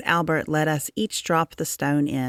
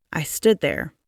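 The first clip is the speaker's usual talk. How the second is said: usual speaking voice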